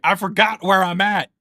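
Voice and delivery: loud realization. Acted. Game master voicing a forgetful official, loud exclamation. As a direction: blurt it out loudly in character. Acting out an NPC's sudden realization.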